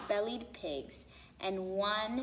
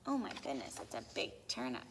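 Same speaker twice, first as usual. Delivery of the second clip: hushed aside; 11 semitones lower, 10 dB quieter, much faster than usual. A narrator's reading. Narrator's astonished aside.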